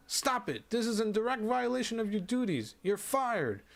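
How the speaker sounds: an outraged doctor firing him on the spot; fuming shout. Acted. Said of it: "the doctor shouts at him".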